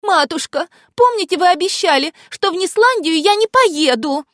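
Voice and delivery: high, imploring plea to mother; pleading princess. A narrator's reading. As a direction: beg sweetly, voice lifted high. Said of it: "умоляюще сложив руки на груди". (умоляющий высокий голосок)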